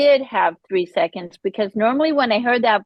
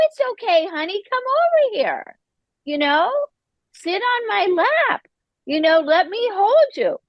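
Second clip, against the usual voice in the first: put-on higher, tender comfort for a little girl; soothing an inner child; 10 semitones higher than usual. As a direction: coax a hurt child onto your lap.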